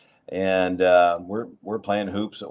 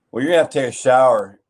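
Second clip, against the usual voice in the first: louder, higher, quick coach command; retold gruff order; 5 semitones higher, 10 dB louder, faster than usual.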